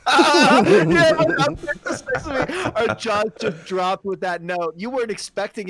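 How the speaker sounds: excited laughing. Real, not acted. Laughing after the high note.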